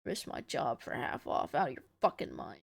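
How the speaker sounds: low, under-the-breath refusal; low scoffing. A narrator's reading. Soldier refusing Joel's half-off offer, low scoffing.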